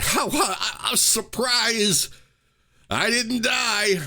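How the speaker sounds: high, slow, giggly disbelief; mock revived character. Fan mocking a revived anime character, high and slow. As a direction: squeak it out like the revived hero, amazed.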